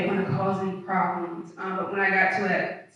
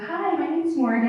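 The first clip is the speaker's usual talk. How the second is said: put-on old polite work voice; 7 semitones higher than usual. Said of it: "like, I used to be like".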